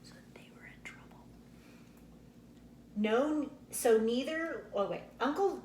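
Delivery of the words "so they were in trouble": quick conspiratorial whisper. A narrator's reading. Reader telling children, quick whisper. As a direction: hush it fast like a secret. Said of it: "unvoiced whisper".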